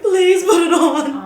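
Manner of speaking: slow, loud pleading through laughter; laughing plea. Retold or imitated. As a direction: beg them, half laughing. Begging strangers to mask up.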